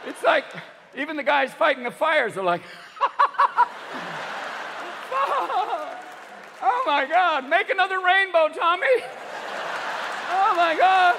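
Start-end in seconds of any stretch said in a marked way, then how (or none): 2.7-6.6 high, long mock stoner laugh; hammed-up giddy cackle; stoned laughter. Acted stoned firefighters. 6.6-11.2 loud, high amazed cries; hammed-up ecstatic yelling; giddy stoner delight. Acted stoned firefighters in delight.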